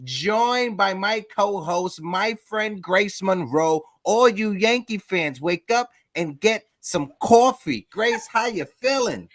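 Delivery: drawn-out, high-pitched morning show intro; show-opening host greeting. Real, not acted. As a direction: open the show big, stretching the greetings.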